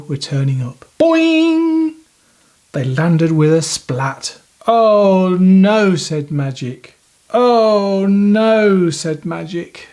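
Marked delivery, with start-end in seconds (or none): 1.0-2.0 cartoon boing. Landing sound effect. 4.7-6.0 loud dismay. Acted. Landing with a splat. 7.3-8.9 mock dismay. Magic repeats dismay.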